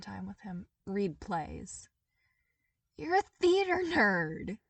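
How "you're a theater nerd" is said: high, loud mocking jab; loud playful ribbing; teasing. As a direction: tease him about his hobby. Toph teasing Zuko.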